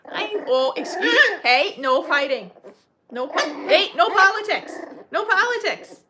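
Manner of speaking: loud, high playful telling-off; loud joking scold at fighting puppies; mock scolding. Real, not acted. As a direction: break up the puppy squabble, half laughing.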